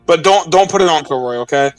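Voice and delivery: usual speaking voice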